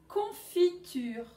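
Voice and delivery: slow, drawn-out Confiture; slow teaching word. Shown as a demonstration. Teacher to pupils, slowly drawn out. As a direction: stretch out Confiture syllable by syllable. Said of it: "vous allez dire si on entend".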